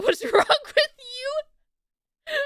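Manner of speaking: high loud laugh-cry whine; mock-outraged through laughter; shrieking with laughter; laughing outrage. Real, not acted. Laughing outrage about eggs.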